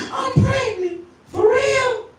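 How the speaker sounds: high woman's voice; girl's announcement. Retold or imitated. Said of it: "came back three weeks later".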